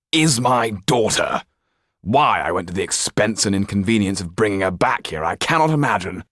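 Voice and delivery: disown her with contempt; abrupt, dismissive. Acted. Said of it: "said the Marquis abruptly".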